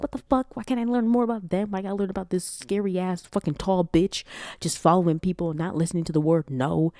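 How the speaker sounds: high-pitched, indignant complaint; exasperated rant. Retold or imitated. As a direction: rant like an annoyed viewer. Annoyed rant recalling own reaction.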